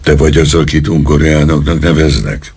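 usual speaking voice

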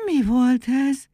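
high, slow, amazed question; astonished exclamation. A narrator's reading. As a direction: draw it out in wonder. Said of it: "kiáltott fel Muki". (csodálkozó, elnyújtott felkiáltás)

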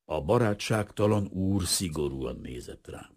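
ordinary delivery

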